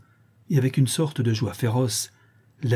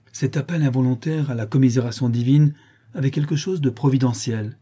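usual speaking voice

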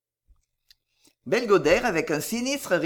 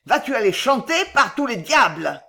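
loud, raised angry bellow; vocifération; 5 semitones higher, 5 dB louder than usual. A narrator's reading. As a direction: bellow it at the girl. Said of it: "vociféra Belgodère". (vocifération coléreuse, forte)

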